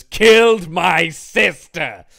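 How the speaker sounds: loud, slow, drawn-out declamation; mock outraged accusation. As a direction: hammer each word out in hammed-up outrage. Acted dismissal letter from grieving brother.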